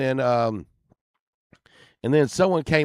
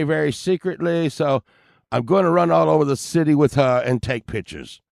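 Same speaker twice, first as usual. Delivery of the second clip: lightly British-voiced prince announcement; mock British accent; 4 semitones higher, 5 dB louder than usual. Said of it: "I can't speak very Britishy".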